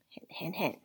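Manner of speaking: soft whispered aside; whispered hint. Shown as a demonstration. Teacher slipping a clue for the second syllable, whispered. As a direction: whisper it quickly as a secret clue.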